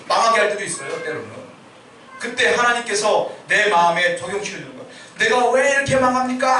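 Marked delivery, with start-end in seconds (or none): 5.2-6.6 higher, mildly raised mock protest; a touch of pleading shout; imitated outcry. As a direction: cry out to God in retold complaint. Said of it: "외치는데".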